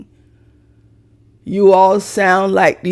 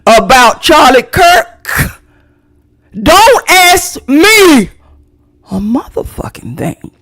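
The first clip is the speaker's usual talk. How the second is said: slow, loud and high, shouting about Charlie Kirk; angry shout; 5 semitones higher, 5 dB louder, slower than usual. Real, not acted. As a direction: bark it at the critics, slowly. Angry rant at critics.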